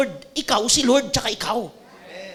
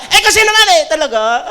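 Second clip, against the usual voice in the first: flustered wife; 3 semitones higher, 7 dB louder than usual. Acted. Wife melting, can't argue.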